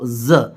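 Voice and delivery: ज as a vibrating "zuh"; buzzing z sound. Shown as a demonstration. Teacher shows learners the letter Z, ज with a buzzing vibration. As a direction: buzz ज like English z, not j. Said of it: "vibration आएगा बोलते वक्त".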